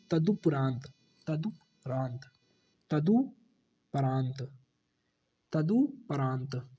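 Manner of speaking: तदुपरांत drawn out very slowly; slow model word. Shown as a demonstration. Teacher slowly dictating the correct word, very slow. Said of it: "क्या होगा शुद्ध रूप".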